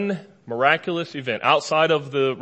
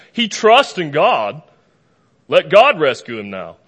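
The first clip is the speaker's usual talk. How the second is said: slow, loud jeering taunt, pitched up; mocking yell; 4 semitones higher, 12 dB louder than usual. Enemies jeering at Jesus on the cross, slow loud yell. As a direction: shout it out slowly like a crowd mocking. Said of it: "People yelling at him".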